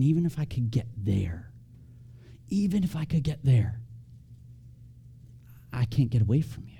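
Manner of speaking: hushed wonder, softly voiced; pondering. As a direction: think it aloud softly, looking up in awe. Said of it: "sitting on his porch, just looking up, thinking".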